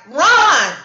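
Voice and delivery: loud high yell of run; shouted warning. Retold or imitated. Calling out to strangers to flee the dust storm, loud and urgent. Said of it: "And, and it's like".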